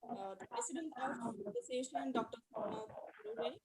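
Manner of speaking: very faint call audio; barely audible. Real, not acted. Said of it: "Hello, madam, you are not audible".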